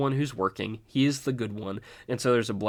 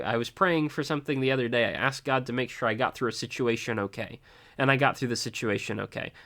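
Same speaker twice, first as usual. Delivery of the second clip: usual speaking voice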